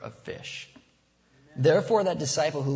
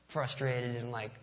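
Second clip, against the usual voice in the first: ordinary talk, nothing marked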